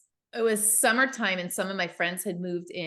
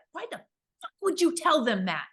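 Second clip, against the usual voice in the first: imitating an angry guy, pitch raised; angry retort; 8 semitones higher, 3 dB louder than usual. Guy caught by the Ouija board turns on his friend, imitated anger. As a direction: snap at your buddy, mock-furious. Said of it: "just, like, anger".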